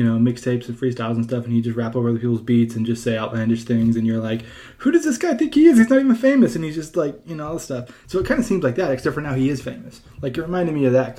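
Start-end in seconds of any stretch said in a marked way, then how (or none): 4.8-6.6 high, loud incredulous scoff; mock outrage. Friend mocking a cocky rapper, high incredulous scoff.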